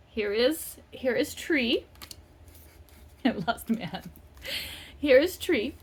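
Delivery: usual speaking voice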